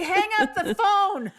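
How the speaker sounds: high, insistent call to hang up; urgent order. Retold or imitated. Wife urgently telling her to hang up the phone, in a high insistent voice. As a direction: bark it like an alarmed spouse.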